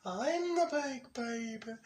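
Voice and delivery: high, slow, drawn-out cartoon voice; big baby voice. Showing the voice once done for a friend, babyish. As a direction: stretch it out in a squeaky baby tone. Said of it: "that's a voice I used to do".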